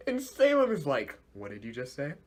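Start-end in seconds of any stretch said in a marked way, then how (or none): 1.3-2.1 low, hushed menace; ominous quiet. Retold or imitated. Salem questioning her henchman, quietly menacing. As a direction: act out a villain's dangerously soft question.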